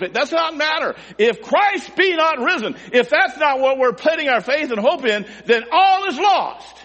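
high, loud pulpit cry; full-force preaching. Real, not acted. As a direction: shout it out as an impassioned preacher, stretching the climax.

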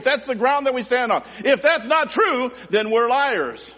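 high, impassioned pulpit delivery; full-force preaching. Real, not acted. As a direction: drive each phrase home like a fervent preacher.

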